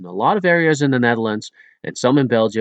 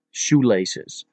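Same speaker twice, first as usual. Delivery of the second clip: ordinary delivery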